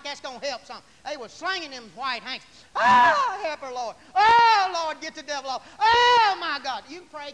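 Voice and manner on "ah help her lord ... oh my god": hammed-up, very loud high cries; mock wailing prayer. Preacher imitating old sisters praying the devil off, wailing. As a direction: wail to heaven, then drop into the plea.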